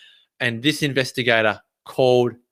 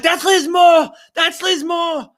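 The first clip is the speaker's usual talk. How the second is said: very high, jubilant shouting; overjoyed, voice shooting right up; elated outburst. Retold or imitated. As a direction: shriek the great news. Celebrating news that books are coming.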